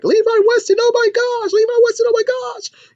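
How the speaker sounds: very high mock fangirl squeal; screaming fans. Whole school seeing the rock star, squealing.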